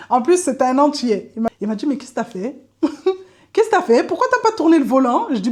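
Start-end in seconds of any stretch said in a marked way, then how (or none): 1.9-5.3 higher, louder exasperated scolding; exasperated instructor. Retold or imitated. Driving instructor scolding her after the failed parking, loud exasperated. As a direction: throw up your hands at the pupil.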